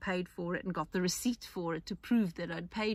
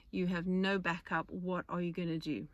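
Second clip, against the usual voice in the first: normal talking voice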